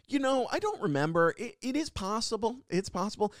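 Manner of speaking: high-pitched mock innocence; sheepish hedging. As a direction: act out a guilty student conceding it might be true. Caught, he hedges to the office.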